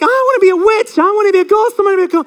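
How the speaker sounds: hammed-up children shouting their costume wishes; excited kids. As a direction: squeal it like eager schoolchildren. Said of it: "everyone's like".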